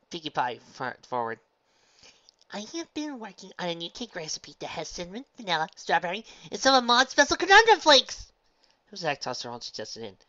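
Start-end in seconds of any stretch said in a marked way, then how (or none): 2.5-8.3 high voice building to loud excitement; bubbly pony. Acted. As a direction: pitch your idea with mounting glee. Pinkie figure pitches cake.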